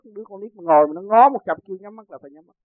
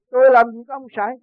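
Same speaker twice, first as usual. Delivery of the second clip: higher, louder retold protest; indignant denial; 7 semitones higher, 8 dB louder than usual. Sorcerer denying he has a spirit, indignant denial. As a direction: act out an offended man denying it. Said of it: "Ông đó sân lắm".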